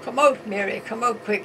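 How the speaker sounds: calling out loudly to Mary; retold shout. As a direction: shout it out the door. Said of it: "the husband shouted".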